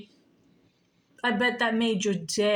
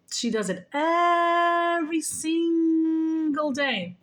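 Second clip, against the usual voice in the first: slow, higher hint of dad's voice; drawn out like dad from above; lightly voiced dad; 7 semitones higher, 3 dB louder, much slower than usual. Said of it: "He goes".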